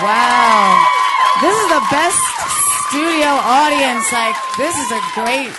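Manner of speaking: loud, high, over the cheers; shouting over crowd. Real, not acted. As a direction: project over a noisy room. Speaking over cheering audience.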